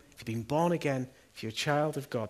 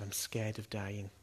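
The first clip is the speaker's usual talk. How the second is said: slow, soft, low admission; hushed retelling of a scared woman; retold frightened confession; 4 semitones lower, 7 dB quieter, slower than usual. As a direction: quietly voice her fear, drawn out.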